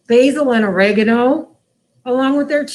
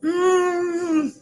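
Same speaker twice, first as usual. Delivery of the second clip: tasting mmm; 5 semitones higher, 3 dB quieter than usual. Real, not acted. Tasting the quesadilla.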